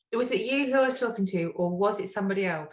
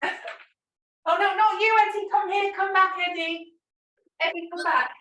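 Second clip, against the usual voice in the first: high, raised call to a dog; 10 semitones higher, 3 dB louder than usual. Real, not acted. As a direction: coax the dog back, higher and louder. Calling the dog back.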